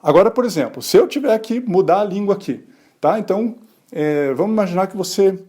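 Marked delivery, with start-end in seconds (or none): none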